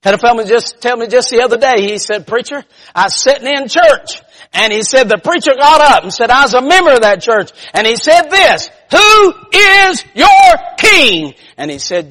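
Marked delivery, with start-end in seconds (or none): 0.0-8.7 loud, raised storytelling from the pulpit; fired-up preaching. Real, not acted. Preacher tells a testimony. 8.9-11.3 very high, slow thundering question; preacher's shouted challenge. Retold or imitated.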